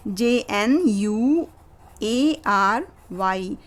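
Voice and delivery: slow spelling. Shown as a demonstration. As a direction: spell the letters slowly, one by one. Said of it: "जैसे".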